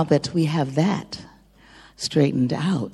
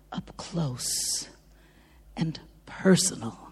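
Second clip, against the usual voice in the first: hushed aside; 3 semitones lower, 7 dB quieter than usual. Acted. Bandleader teasing the audience about the bassist, hushed. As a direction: drop to a near whisper on up close.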